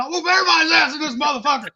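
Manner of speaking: fast, high mock yell; hammed-up drunk challenge, rushed; riled-up boast. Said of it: "I was fucking riled up".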